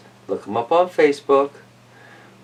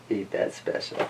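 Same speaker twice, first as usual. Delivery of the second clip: whispered aside; 5 semitones lower, 14 dB quieter than usual. Acted. Teasing viewers about masks, whispered. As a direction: sly whisper to the camera. Aside after mask remark.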